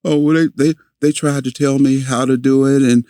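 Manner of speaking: put-on a light take on a stammering boy; kid explaining. Boy answering grandma.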